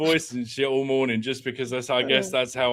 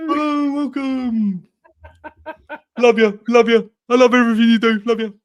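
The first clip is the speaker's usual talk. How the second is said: much higher, louder squeaky gushing; high excited puppet voice, laughing; Roland Rat voice; 9 semitones higher, 5 dB louder than usual. Pretend. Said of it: "[name] is Roland Rat".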